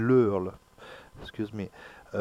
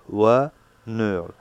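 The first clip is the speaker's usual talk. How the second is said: "Wa" punched loud, "neul" dropping off; teacher's demo with loud "Wa"; stress on WA; 7 dB louder than usual. Shown as a demonstration. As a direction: hit the first syllable "Wa" hard. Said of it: "The stress is on the first".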